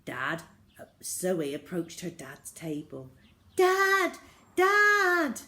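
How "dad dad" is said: high, loud calling out; shouting. A narrator's reading. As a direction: shout to wake him. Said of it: "she shouted".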